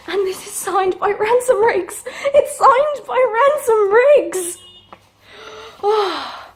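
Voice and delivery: high, loud, slow excited squeal; can barely contain the joy; squealing over a signed copy; thrilled squeal. Real, not acted. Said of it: "oh, I'm so excited".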